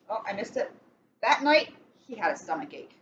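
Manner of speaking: ordinary delivery, nothing marked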